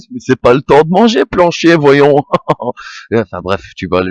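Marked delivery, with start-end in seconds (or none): 0.1-2.2 mock scolding. Mocking a film character's line.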